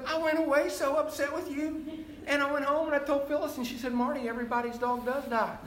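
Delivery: high, loud mock voice for his friend Marty; retold friend. Old friend Marty reminding Sam of a past remark, in a high, loud teasing voice.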